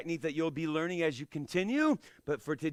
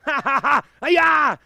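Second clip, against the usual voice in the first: laughing; 8 semitones higher, 13 dB louder, slower than usual. Real, not acted. Laughing with class.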